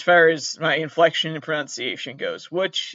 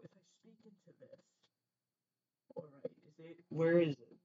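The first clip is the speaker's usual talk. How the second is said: breathy whisper right into the keyboard; whisper into the mic; 13 dB quieter than usual. Real, not acted. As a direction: test the mic with a close whisper. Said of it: "when I talk right into the keyboard".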